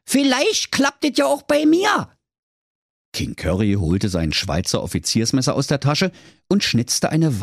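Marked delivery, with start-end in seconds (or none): 0.1-2.1 put-on high shouted brave-boy boast. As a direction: crow it out cockily. Thorsten ends his speech.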